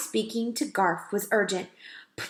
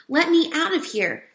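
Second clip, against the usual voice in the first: a mild plea, higher; pleading; 8 semitones higher, 3 dB louder than usual. A narrator's reading. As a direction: beg gently to be let out. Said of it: "pleaded Ralph".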